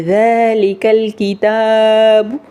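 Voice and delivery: slow "ذالک الکتاب" with a light front ک; stretched recitation with light kaaf in "ذالک الکتاب"; thin kaaf. Shown as a demonstration. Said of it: "تو اس کو باریک پڑھیں گے".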